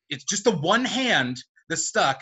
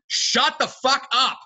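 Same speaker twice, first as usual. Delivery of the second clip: exasperated; 8 semitones higher, 4 dB louder, slower than usual. Real, not acted. Exasperated reaction to Spider-Man writing.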